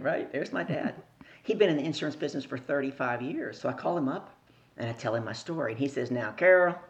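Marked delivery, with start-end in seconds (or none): 6.1-6.8 put-on Southern accent. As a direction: call her name like a Southern dad. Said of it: "I told you he's from the deep South. He got an accent".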